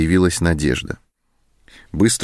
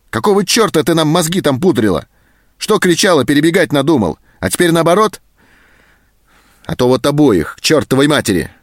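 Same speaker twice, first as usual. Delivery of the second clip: higher, louder angry rant; raging tirade; 9 semitones higher, 4 dB louder than usual. A narrator's reading. Sergeant berates Zoska for lying, raging. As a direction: keep the fury going. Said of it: "взъярился сержант".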